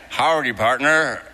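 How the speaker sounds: mock Southern Texan twang, cowboy style; Texan accent. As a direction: tease the locals in a hammed-up Texas accent. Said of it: "what's up with y'all's accent here".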